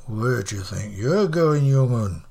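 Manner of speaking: put-on low-pitched old northern man; challenging old man. Old Fred with walking stick blocks his path, challenging.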